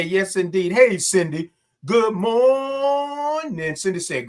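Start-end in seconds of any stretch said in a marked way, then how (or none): 1.8-3.8 high, long-held "mooornin'"; drawn-out greeting. Real, not acted. Host greets Cindy as she joins, drawn out high. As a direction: stretch the morning out long and high. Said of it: "I love all those O's and R's".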